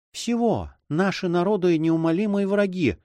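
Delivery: high-pitched anxious woman's voice; high, worried character voice; fearful heroine. Acted. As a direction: play a frightened lady, pitched well up. Heroine confesses her fear.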